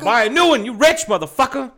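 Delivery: high yelled mock tirade; shouting. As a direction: yell it like Chappelle. Quoting Chappelle bit.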